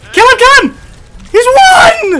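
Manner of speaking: high, loud frantic callouts; hyped shouting. Real, not acted. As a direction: yell at the team to finish him.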